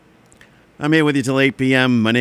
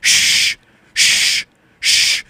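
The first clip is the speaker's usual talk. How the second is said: neighbor shushing; much slower than usual. Retold or imitated. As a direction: shush like you're scared of waking the street. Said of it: "here's an impression of my neighbor".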